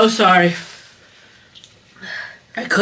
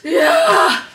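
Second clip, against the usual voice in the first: excited shout. Real, not acted. Cheering the pepper challenge.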